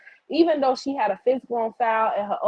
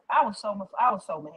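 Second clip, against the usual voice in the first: normal talking voice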